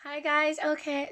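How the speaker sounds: ordinary talk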